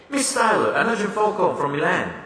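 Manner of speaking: high, hurried call across the lobby; urgent announcement. Acted.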